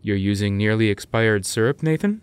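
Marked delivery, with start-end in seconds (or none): 1.8-2.2 name jumps up in disbelief; incredulous high rise. Acted. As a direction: ask the name high and rising, shocked. Incredulous question to Nathan.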